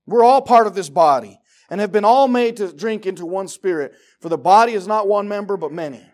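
loud, high fervent sermon shout; full-force preaching. Acted. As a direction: drive the point home to the congregation.

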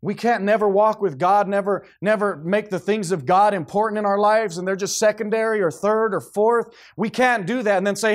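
loud, high fiery sermon voice; full-force preaching. Acted. Pastor warning parents, preaching loud and fervent. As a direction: preach it out with full power to the church.

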